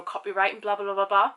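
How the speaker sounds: ordinary talk, nothing marked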